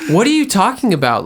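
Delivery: high, mock-baffled comeback; incredulous denial. Gaslighting partner answering a complaint after dinner, incredulous. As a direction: throw it back as if truly baffled.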